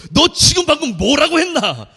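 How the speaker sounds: high, loud furious question; a drill instructor losing his temper; angry drill instructor. Retold or imitated. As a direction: instructor snapping at the recruit. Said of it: "조교가 열받아서".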